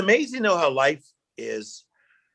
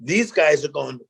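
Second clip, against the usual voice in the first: normal talking voice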